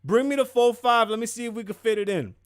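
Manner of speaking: loud, high mock shout across the room. [name] yelling for her gun, loud mock holler. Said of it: "she's like".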